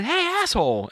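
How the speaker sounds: high-pitched mock yell, no real volume; retold yell. Dad calling out to a rude passer-by, retold yell. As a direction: call out indignantly, pitched well up. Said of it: "I yelled out to the guy".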